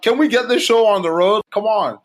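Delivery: high playful impatience; impatient. Retold or imitated. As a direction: hurry him along, half joking. Impatient quote to admissions caller.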